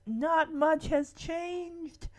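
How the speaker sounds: slow wistful line. Retold or imitated. Fernando wistfully surveys old room.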